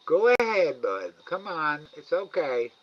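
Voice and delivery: high, loud sing-song coaxing of an animal; coaxing pet talk. Real, not acted. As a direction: coax the shy raccoon to come and eat. Coaxing the raccoon.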